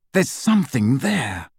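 plain reading voice

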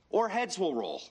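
ordinary talk

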